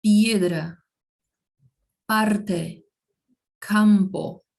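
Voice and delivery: "piedra", "parte", "campo" as firm, separate models; Spanish P examples. Shown as a demonstration. Teacher giving learners P examples, careful isolated Spanish words. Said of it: "tem o mesmo som que em português".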